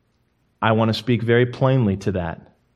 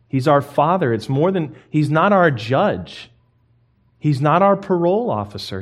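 ordinary delivery

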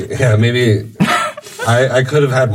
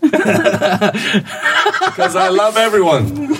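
laughing; 7 semitones higher, much slower than usual. Real, not acted. Laughing reply to teasing.

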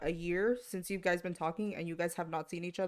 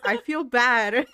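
loud, drawn-out guilty complaint; mock guilt; 4 semitones higher, 9 dB louder, slower than usual. Mom seeing sad friends leave, exaggerated guilt. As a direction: play up feeling bad, slowly and loudly.

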